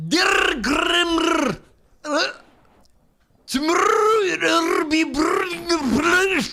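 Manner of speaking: strained Scottish attempt. Shown as a demonstration. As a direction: push the Scottish voice up into a near-shout. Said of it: "Grandma, go".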